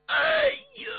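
sudden piercing high cry of shock; great cry. Acted. Shengying hearing the news, crying out in shock. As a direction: cry out as if struck by terrible news. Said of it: "大叫一声".

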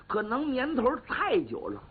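ordinary delivery

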